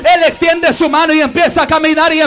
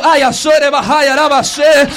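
speaking in tongues. Real, not acted. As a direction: pray in tongues with fervor. (hablando en lenguas)

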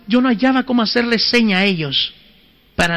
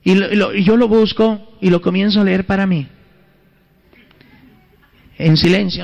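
ordinary talk

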